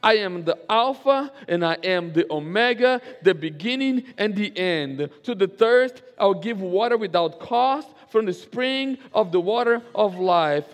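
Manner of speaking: slow, loud, high scripture proclamation; preaching at full force. Acted. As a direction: proclaim each line of Revelation with building fervour.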